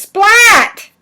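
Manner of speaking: high shouted Splat; loud exclamation. Acted. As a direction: belt it out, cartoon style.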